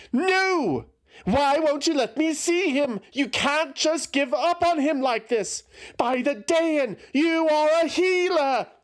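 very high, loud outraged cries; furious scream. A narrator's reading. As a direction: rage at the healer. Eothas screams at healer.

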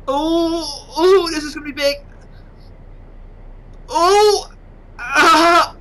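high thrilled gasps of oh; excited oh's. Real, not acted. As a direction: build up to the big crash, thrilled. Ship crashing, excited anticipation.

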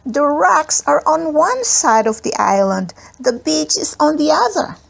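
put-on high, worried child's voice; a little boy fretting aloud; small boy voice. A narrator's reading. Smokey Joe frets.

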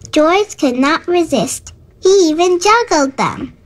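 ordinary delivery, nothing marked

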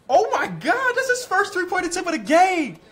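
high, loud celebration; ecstatic shout. Real, not acted. Gamer celebrating a clutch three, ecstatic shouting. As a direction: scream with disbelief at the shot. Shot goes in.

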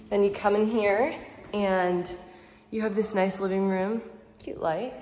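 ordinary talk

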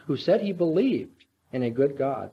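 ordinary talk, nothing marked

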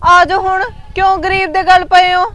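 loud, exasperated retort at her husband; wife snapping loudly at her suspicious husband; angry outburst. Acted. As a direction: shout him down in frustration. Wife confronts husband in quarrel.

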